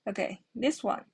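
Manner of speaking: usual speaking voice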